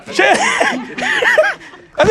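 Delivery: breaking into laughter mid-sentence; laughing. Real, not acted. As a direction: laugh at your own story. Laughs at himself.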